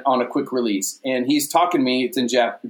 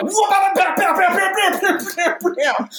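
lightsaber battle noises. Retold or imitated. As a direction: blast out each hit like a kid playing swords. Acting out lightsaber beating.

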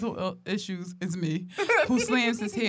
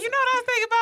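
high-pitched squealing quote; mock high voice; 5 dB louder, much faster than usual. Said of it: "You was like".

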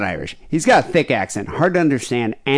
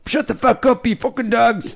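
much higher mock-Irish ranting; mimicking the caller; 13 semitones higher than usual. Host replaying the caller's rant at his dogs, high-pitched mimicry. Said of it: "The only word I could really understand".

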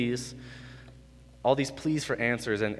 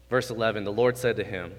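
ordinary talk, nothing marked

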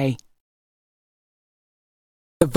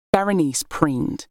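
ordinary delivery, nothing marked

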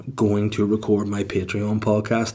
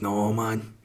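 softer, dismissive Scottish voice; Scottish accent; 6 dB quieter than usual. Acted. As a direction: refuse like a weary Scot. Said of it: "I don't know why it's Scottish".

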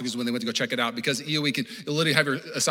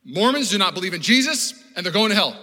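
loud, raised preacher's declaration; mock hard-line sermon; platform hellfire preaching; 5 semitones higher, 6 dB louder than usual. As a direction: declare it forcefully from the pulpit. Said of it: "if I said this on platform".

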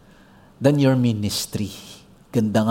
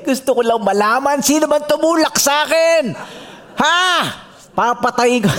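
angry winner; 10 semitones higher than usual. Retold or imitated. Winner wants to know who pushed him in, mock angry.

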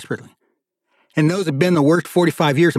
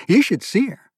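higher-pitched, eager urging; excited younger brother; 9 semitones higher than usual. Acted. Younger brother telling Thierry about a woman, excited. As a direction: rave about her to your brother.

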